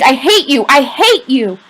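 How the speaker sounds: repeated loud, high screams; screamed. A narrator's reading. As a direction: keep screaming it. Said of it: "Rowdy screamed".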